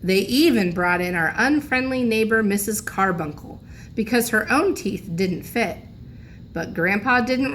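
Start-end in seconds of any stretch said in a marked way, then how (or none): none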